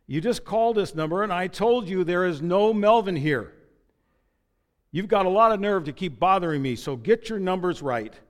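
loud, high, indignant telling-off; furious neighbor scolding a repeat caller; angry scolding. Acted. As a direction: rant at the caller who keeps bothering you.

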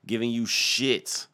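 loud, drawn-out indignant refusal; angry outburst. Acted. Telling off a panhandler, angry drawn-out outburst. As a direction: stretch it out and shout it. Furious comic reply to panhandler.

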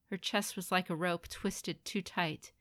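ordinary delivery, nothing marked

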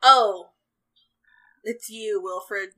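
put-on an unimpressed old man, loud on the oh; lightly voiced cranky old farmer; grumpy old farmer. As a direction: sour greeting to an old pal. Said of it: "cocks his head and looks up and goes".

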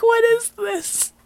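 very high, drawn-out mock disbelief; incredulous squeal. Viewer reacting to the god voice scene, incredulous.

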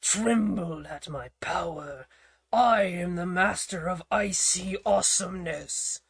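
lightly voiced cold bully hollering; a hint of a menacing kid overlord; mock villain boast. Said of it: "a cold voice holler".

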